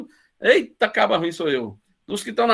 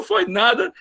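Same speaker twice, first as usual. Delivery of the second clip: usual speaking voice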